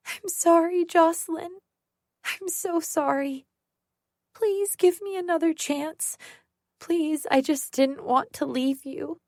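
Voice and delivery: put-on high, tearful childlike pleading; sobbing like a little girl. Sarah sobbing on the floor, begging Jocelyn in a high childlike voice. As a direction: beg like a small child caught crying. Beaten Sarah whimpering apologies.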